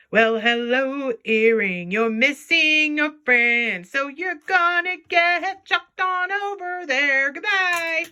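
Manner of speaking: put-on slow, high baby-talk to an earring. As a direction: coo at the lonely earring, then chuck it. Talking to an earring.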